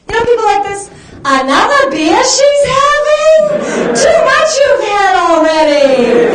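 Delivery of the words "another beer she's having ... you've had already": high, loud, slow mock aunt with words backwards; backwards-talking aunt. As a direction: play the auntie who speaks in reverse, slowly. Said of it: "Everything she says comes out backwards".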